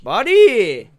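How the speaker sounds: high, loud hail to a pal; real, hearty hello; excited greeting. Real, not acted. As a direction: call out happily to a friend. Greeting a caller joining in.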